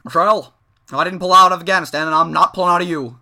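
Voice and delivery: mock-serious commanding tone; stern presidential. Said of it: "real stern and tough and presidential".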